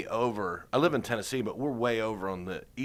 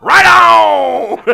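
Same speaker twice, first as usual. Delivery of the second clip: much higher, louder manic shout; imitated shout; 18 dB louder than usual. Cheering about the football game, loud imitated shout. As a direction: yell it like Busey. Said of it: "He's like".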